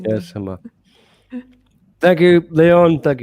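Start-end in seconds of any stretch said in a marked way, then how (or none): 2.0-3.0 bright loud thanks; loud sign-off. Real, not acted. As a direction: sign off loudly. Signing off the show.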